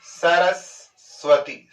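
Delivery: सरस्वती said slowly with the स held and doubled, स्स-रस्वती; slow syllable split. Shown as a demonstration. Teacher demonstrates to students: सरस्वती drawn out with the स repeated. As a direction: pause on the half स in सरस्वती so it sounds twice. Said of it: "आप बोलोगे रुककर तो कैसी ध्वनि आएगी".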